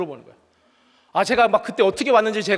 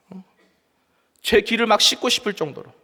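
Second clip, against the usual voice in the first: normal talking voice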